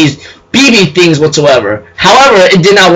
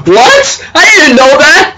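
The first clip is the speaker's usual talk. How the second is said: high, whimpering fake surprise; mock shock; 9 semitones higher than usual. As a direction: squeak out a guilty surprise. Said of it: "He goes like".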